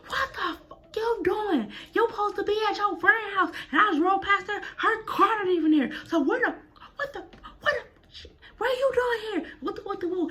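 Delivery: high, hammed-up mom voice scolding; mama's high mock scold at her sneaky daughter; imitating angry mama. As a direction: play the mother catching her daughter out, shrieking high. Mother catching her at friend's.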